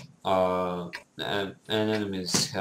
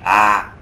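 short, very loud "ah"; startled shout; 11 dB louder than usual. Real, not acted. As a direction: cry out as if suddenly caught. Caught out in the game.

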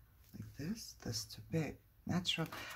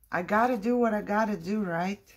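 higher, brisk self-pep-talk; resolute; 5 semitones higher, 4 dB louder than usual. Real, not acted. Crafter steels herself to glue, resolute. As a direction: psych yourself up, quick and raised.

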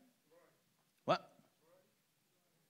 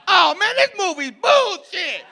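high, loud, slow yell at the screen; mock moviegoer yell; 9 dB louder, slower than usual. Imitating a moviegoer behind him complaining, loud yell. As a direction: holler your complaint at the screen.

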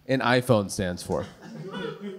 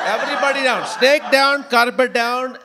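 put-on higher, louder bossy Indian accent; mock Indian accent; 10 semitones higher, 6 dB louder than usual. Rehearsing in the parking lot, loud mock Indian accent. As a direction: bark orders in a hammed-up Indian accent. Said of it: "I practiced in the parking lot".

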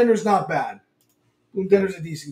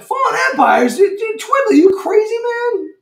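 much higher and louder, laughing; laughing disbelief; 6 dB louder than usual. Real, not acted. Host reacting to a viewer asking for Fallen Empires, laughing high. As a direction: squeal at the crazy request.

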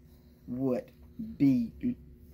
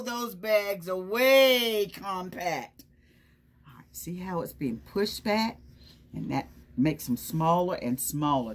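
ordinary delivery

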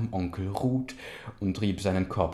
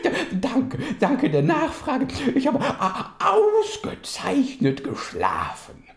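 high laughing cub voice; 9 dB louder than usual. Acted.

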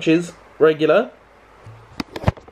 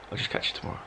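whispered tired; 13 dB quieter, much faster than usual. Real, not acted. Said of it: "I'm so tired".

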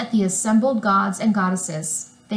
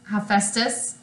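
usual speaking voice

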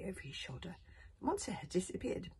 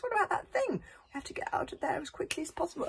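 panicky; 11 semitones higher than usual. Acted. Fishlegs panicking about the monster.

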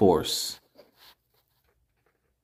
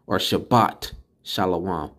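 ordinary delivery

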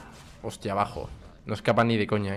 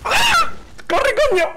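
high loud scream of panic; panicked shout; 5 dB louder, slower than usual. Real, not acted. Gamer panicking in a fight, screaming. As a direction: scream at the character to run.

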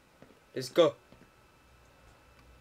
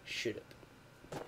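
mimicked game voice; 5 semitones lower, 12 dB quieter than usual. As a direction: imitate a game scientist, stretching the last words. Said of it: "the voice is lacking a bit today".

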